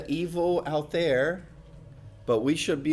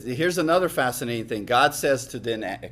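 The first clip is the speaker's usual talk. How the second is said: normal talking voice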